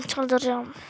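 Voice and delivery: soft, resigned mutter under the breath; defeated mutter. Real, not acted. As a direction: say it to yourself, giving up. Hemen tekrar, kısık. (kendi kendine kısık mırıltı)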